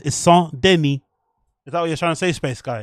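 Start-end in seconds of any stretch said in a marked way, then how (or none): 0.0-1.0 "Saint Denis" with a silent S on Saint, said "san deni"; careful French "Saint Denis", both S sounds silent; French pronunciation. Shown as a demonstration. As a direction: drop the S of "Saint" and the final S of "Denis". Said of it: "Stop pronouncing the S at the end of Saint".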